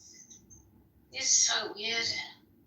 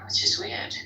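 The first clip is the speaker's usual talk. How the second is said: ordinary talk, nothing marked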